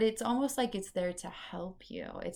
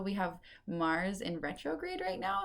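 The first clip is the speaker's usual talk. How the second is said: ordinary talk, nothing marked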